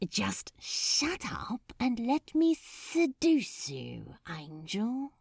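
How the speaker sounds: slow, breathy whisper right in his ear; seductive whisper. Acted. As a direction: murmur it slowly, all breath and no voice. Demon seducing the angel up close.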